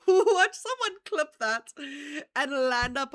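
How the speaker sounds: slow, high, loud laughing squeal; loud and high, helpless with laughter. Real, not acted. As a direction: squeeze the words out through laughter. Still cracking up at own joke.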